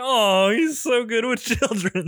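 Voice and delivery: high, sugary mock admiration; gushing onlooker. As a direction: coo it like a doting parent.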